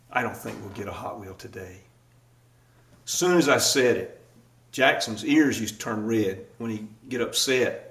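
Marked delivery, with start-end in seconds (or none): 0.1-1.8 put-on soft, tender let-down to a little boy; gentle fatherly refusal. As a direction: break it to him gently, much quieter. Dad gently refusing his son.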